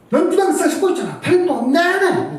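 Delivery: high-pitched angry rant at the daughter; retold angry scolding. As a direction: pour the anger out on her. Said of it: "막 진짜 막 퍼부어서 그랬어요".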